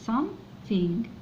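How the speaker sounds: something very slowly and softly, th as s; something stretched and soft. Shown as a demonstration. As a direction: drag out something quietly. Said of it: "تنطق س". (نطق something ببطء وهدوء)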